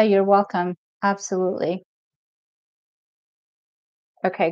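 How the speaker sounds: ordinary delivery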